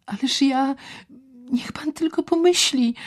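woman suddenly remembering, crying out; sudden exclamation. Acted. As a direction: blurt it out as it hits her. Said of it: "zawołała raptownie".

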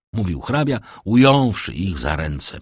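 ordinary delivery, nothing marked

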